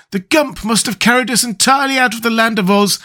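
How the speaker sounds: high-pitched, woeful Scarecrow; doleful. A narrator's reading. Scarecrow tells his friends they have left Oz, dolefully. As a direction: lament it in a high voice. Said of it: "said the Scarecrow dolefully".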